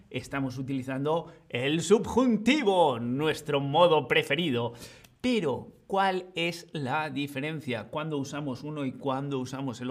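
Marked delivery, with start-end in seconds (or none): none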